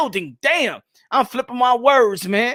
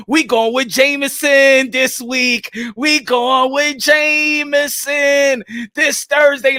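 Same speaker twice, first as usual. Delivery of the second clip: stretched-out, announcer-style reveal; slow announcer drawl; much slower than usual. Real, not acted. Host drawing out the announcement of Jameson whiskey. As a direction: draw each word out like a big reveal.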